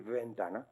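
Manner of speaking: VENTANA with a buzzing v sound; fricative V. Shown as a demonstration. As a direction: start ventana on teeth and lip, not both lips. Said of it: "con la V fricativa".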